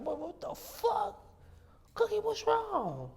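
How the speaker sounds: high, slow, squeaky voice on the phone; high squeaky retold call. Calling Cookie, voices the phone call.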